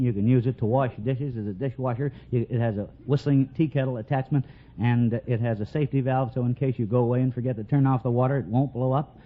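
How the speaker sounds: normal talking voice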